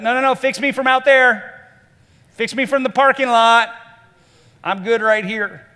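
high, loud call to someone outside; loud mock refusal. Person keeping Jesus outside the door, loud mock protest. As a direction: shout them off from a distance, hammed up.

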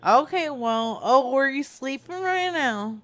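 ordinary delivery

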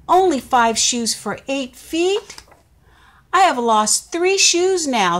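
high, loud dismayed spider voice; upset little spider. Acted. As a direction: panic gently over the lost shoes. Spider finds shoes missing.

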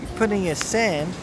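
usual speaking voice

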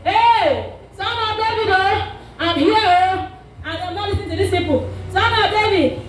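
high-pitched insistent cry, half shouted; retold defiant shout. Blind man refusing to listen to the crowd, retold shout. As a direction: call out over people trying to silence you. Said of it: "He shouted".

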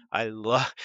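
normal talking voice

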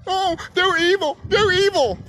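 high-pitched mock alarm; mocking accusers. Imitating people calling others evil, mocking. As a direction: whine it out in a silly high voice.

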